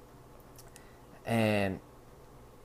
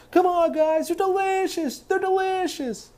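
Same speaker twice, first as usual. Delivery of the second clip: squeaky high-pitched Jim voice; high goofy voice; slower than usual. Retold or imitated. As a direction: go way up into a silly squeak. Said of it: "he was sitting there going".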